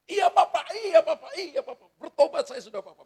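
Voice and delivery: put-on high, loud, pleading child's voice with a laugh; begging child. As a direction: beg like a scolded kid promising to repent. Son begging father after phone. (anak merengek memohon ke papa dengan suara tinggi dan keras)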